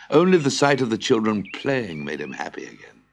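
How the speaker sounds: usual speaking voice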